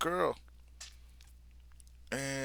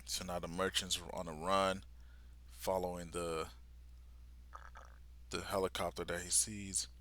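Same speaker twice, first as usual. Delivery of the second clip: ordinary talk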